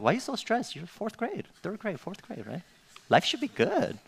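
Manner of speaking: high-pitched retold disbelief; incredulous dad. Dad to stressed fourth-grade daughter, mock incredulous. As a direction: act out a dad who can't believe it, voice up high.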